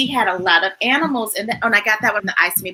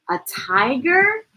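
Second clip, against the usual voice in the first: plain reading voice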